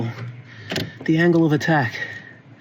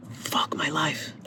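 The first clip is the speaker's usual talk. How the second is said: slow, soft whispered curse; despairing whisper; 2 semitones higher, 9 dB quieter, much slower than usual. Real, not acted. A man stuck on the repair whispers his despair to himself. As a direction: breathe it out in defeat.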